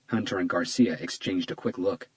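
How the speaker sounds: usual speaking voice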